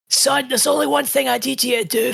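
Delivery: high, strained Scottish dad with a thick brogue; Scottish old man voice. Retold or imitated. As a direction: play a pleading old Scottish father. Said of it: "you upped your accent for Tallarico's dad".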